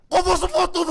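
high, loud gloating yell; excited kill shout. Pretend. Gamer celebrates a headshot to his teammate, yelling. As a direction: crow loudly over a game kill. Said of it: "Abi sakin ol ya".

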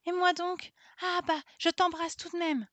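voix d'enfant très aiguë et gaie; fonce, joyeuse et insouciante; petite fille espiègle. A narrator's reading. Marguerite veut embrasser Camille. (Marguerite, petite, lance à Camille sa joie espiègle)

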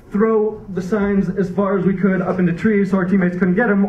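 ordinary delivery

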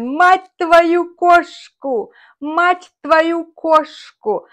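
high, drawn-out mock swearing; mock cursing. Sasha swears at Messi the cat, mock cursing. Quoted cat curse, acted.

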